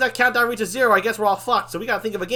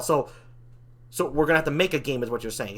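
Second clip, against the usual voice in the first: ordinary talk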